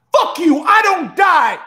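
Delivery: high, loud battle cry; full-force acted defiant shout; defiant yell. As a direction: scream your refusal at the devil. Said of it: "Like that".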